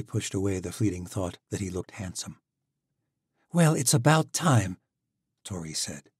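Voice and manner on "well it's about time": higher, louder, tart jab; impatient sarcasm. Acted. Tori scolds Alec for arriving late, higher and louder. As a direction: let him know he's late.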